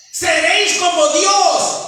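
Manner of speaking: high, loud, slow seductive promise; tempting serpent. Acted. The serpent tempting Eve, high, loud and slow. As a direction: lure them like the serpent in the garden. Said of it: "La serpiente ofrece".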